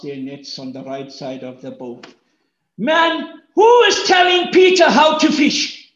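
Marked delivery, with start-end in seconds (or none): 2.8-5.9 indignant. Acted. Preacher voicing Peter's indignation.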